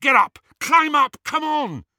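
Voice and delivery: high, loud urgent orders; urgent shouting. A narrator's reading. Harry urging friends onto the dragon, urgent shout. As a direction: shout each command high and hard.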